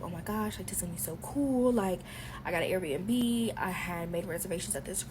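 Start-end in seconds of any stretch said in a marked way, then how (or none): 0.0-1.7 excited whisper. Retold or imitated. Her excited plans for his birthday.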